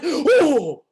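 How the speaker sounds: high loud excited "oh"; high excited dog gasp; dog's excited yelp. Retold or imitated. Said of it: "he actually speaks in melody".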